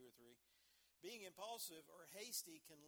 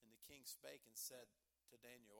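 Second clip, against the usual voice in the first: ordinary talk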